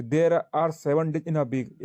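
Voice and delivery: dictating word by word to students; teacher reading an example aloud, very slowly; slow dictation. Shown as a demonstration. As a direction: read the sentence out very slowly. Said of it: "है ना, कि".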